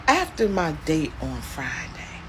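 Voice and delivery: normal talking voice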